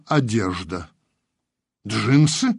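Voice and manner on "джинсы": astonished one-word question, rising; surprised exhale. A narrator's reading. Rita spotting modern clothes on a skeleton, surprised. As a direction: breathe it out in mild surprise. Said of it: "удивлённо выдохнула Рита".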